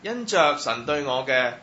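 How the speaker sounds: ordinary talk, nothing marked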